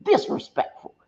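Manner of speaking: high, put-on voice of a friend scolding through a laugh; imitating Cindy. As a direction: mimic a friend calling the kids disrespectful. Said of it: "had Cindy's voice in my head".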